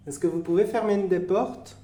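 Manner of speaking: loud and clear to the dark; calling out. Acted. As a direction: ask the spirits loudly to act. Demande aux esprits de fermer. (appel fort aux esprits)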